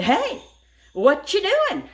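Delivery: high, loud confronting call; puffed up, calling out a stranger; bold challenge. Retold or imitated. Homeowner confronts a man in her yard, bold and loud. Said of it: "I was as bold as I could be".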